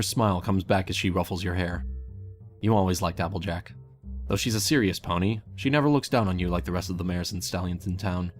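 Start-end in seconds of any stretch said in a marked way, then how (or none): none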